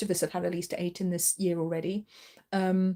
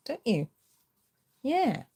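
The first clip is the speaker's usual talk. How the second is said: high pet voice; 5 semitones higher, slower than usual. Real, not acted. Teasing the cat.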